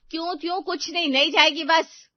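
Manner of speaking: loud, high scolding; angry mother. A narrator's reading. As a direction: shut the child down forcefully. Angry mother forbids her daughter.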